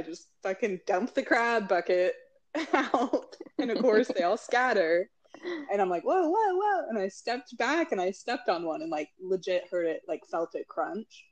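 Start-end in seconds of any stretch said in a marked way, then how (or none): 6.0-6.9 high alarmed whoas; startled whoa. Retold or imitated. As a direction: back away from scattering crabs. Crabs scattering everywhere.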